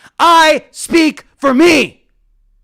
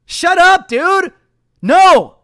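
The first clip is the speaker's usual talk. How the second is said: high, forceful dismissal; fed-up outburst, pitched up; indignant rebuke; 4 semitones higher, 4 dB louder, much slower than usual. Real, not acted. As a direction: shut them down with a higher, punchy voice. Rebuking politicians in a rant.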